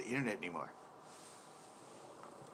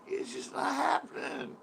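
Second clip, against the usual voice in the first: high squeaky mock voice. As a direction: squeak it out apologetically. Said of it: "and I'm like".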